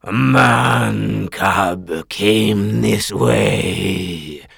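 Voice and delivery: slow, loud menacing tiger voice; angry tiger. Acted. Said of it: "he was very angry".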